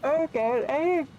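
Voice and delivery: talking through a yawn; words stretched by a yawn. Real, not acted. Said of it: "I'm so sorry about the yawns".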